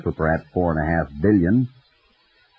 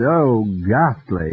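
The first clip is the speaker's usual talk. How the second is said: mock horror; much slower than usual. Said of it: "tearing at his hair".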